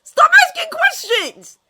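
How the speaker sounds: loud, slow frantic yell; frantic shout. Acted. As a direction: shout it waving your arms. Fishlegs waving arms maniacally.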